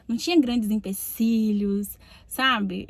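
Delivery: ordinary delivery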